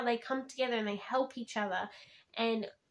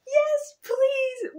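much higher, louder, eager squeal; eager excited yes; 14 semitones higher, 7 dB louder than usual. Retold or imitated. Reader reacting to the epilogue, high excited squeal. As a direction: beg for it like a thrilled fan. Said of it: "I was like".

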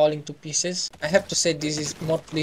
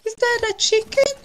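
much higher, rising amazed ask; surprised squeak; 7 dB louder than usual. Real, not acted. Player spotting a chicken, high surprised squeak. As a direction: squeak the question in disbelief.

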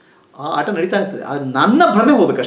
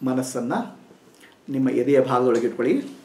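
soft, slow meditation voice with long pauses; calm meditation guidance, softer and lower; guided meditation; 6 semitones lower, 6 dB quieter than usual. Acted. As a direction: guide them inward, gently and unhurried.

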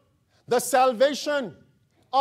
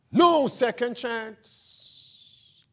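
very slow; much slower than usual. Real, not acted. Slow solemn punchline.

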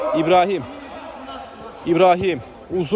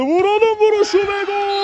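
screaming football commentator; 3 dB louder than usual. Real, not acted. Goal call in live commentary.

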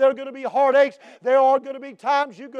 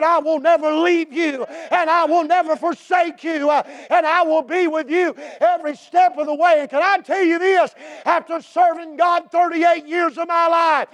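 loud, higher preacher's climax; preaching at full force; 5 semitones higher, 3 dB louder than usual. Acted. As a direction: testify with rising fervor.

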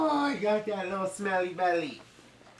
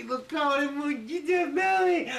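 higher and louder, mock threat; playful shout; 9 semitones higher, 6 dB louder than usual. Real, not acted. Dad warning baby of the tickle, playful shout. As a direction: pounce with a playful yell.

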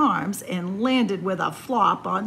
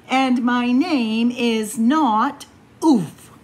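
louder, slow, every word placed; firm insistence; 7 dB louder, slower than usual. Acted. Warren correcting the animals, louder and slow. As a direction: stand firm on your own name.

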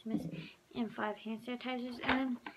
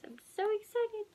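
excited; 10 semitones higher than usual. Real, not acted. Excited haul reveal.

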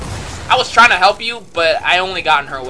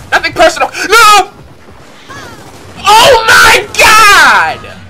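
frantic yell; 11 semitones higher, 8 dB louder, slower than usual. Real, not acted. Trade kills, then dies.

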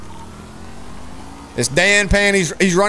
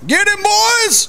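cheering shout; 12 semitones higher, slower than usual. Real, not acted. Cheering police on.

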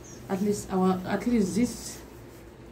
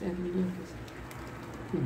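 softer, slowly savouring each word; soft slow repeat; 10 dB quieter, much slower than usual. Real, not acted. Vlogger admiring her new laptop, soft and slow. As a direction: murmur it fondly to yourself.

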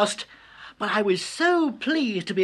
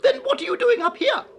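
cartoon bird voice; 12 semitones higher, much faster than usual. A narrator's reading. Bluebird asks.

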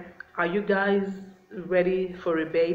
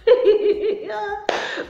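high bursting laugh, embarrassed at herself; burst of laughter; 7 dB louder than usual. Real, not acted. Laughing at herself while talking to the viewers, high burst of laughter. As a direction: laugh out loud at your own talking. Laughs at her own accent.

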